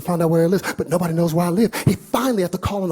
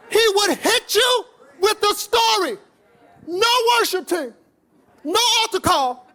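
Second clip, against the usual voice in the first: fiery preaching; slower than usual. Real, not acted. Preaching at full force.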